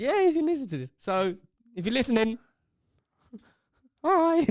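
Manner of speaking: high and loud, giddy hello; excited greeting. Real, not acted. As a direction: squeal a hello to the listener.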